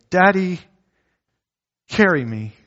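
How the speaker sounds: high little-girl plea, lightly voiced; a child calling Daddy to be carried; imitating a small daughter.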